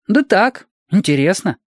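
put-on high, offhand child's reply; high-pitched, would-be casual child; casual little boy. A narrator's reading. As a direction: shrug it off as a curious boy. Boy feigns casual interest.

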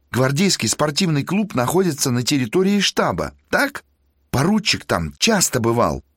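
high, insistent tenor; eager student. Acted. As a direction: press each point eagerly.

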